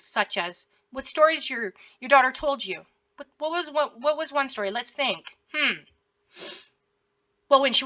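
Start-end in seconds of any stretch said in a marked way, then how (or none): none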